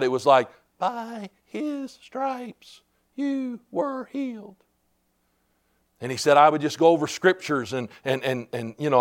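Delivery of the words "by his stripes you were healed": very soft, slow, higher-pitched; a faint, drawn-out voice from within; low inner voice. Retold or imitated. Inner voice to a bedridden man, hushed and slow. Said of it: "like, low on the inside".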